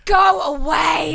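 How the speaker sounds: slow, drawn-out mock yell, pitched high; mock shout. Podcast host mock yells at the wrestler, slow and loud. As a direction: bellow it slowly like a heckler.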